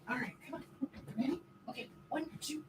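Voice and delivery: hushed coaxing. Real, not acted. Coaxing dog, counting.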